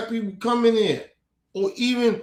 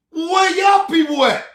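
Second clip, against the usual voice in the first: exasperated shout; 8 semitones higher, 5 dB louder than usual. Real, not acted. Angry rant at other groups.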